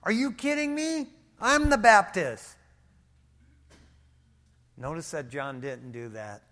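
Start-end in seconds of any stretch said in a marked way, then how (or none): 0.0-2.5 high, loud, offended outburst; mock-indignant, louder and higher; indignant attitude. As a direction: play the insulted prophet. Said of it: "John could have got an attitude".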